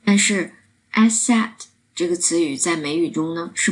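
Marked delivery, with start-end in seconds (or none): 0.9-1.5 higher-pitched asSET; "asset" as a-SET, the wrong way; second-syllable stress. Shown as a demonstration. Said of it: "中音的位置放在了第二个音节".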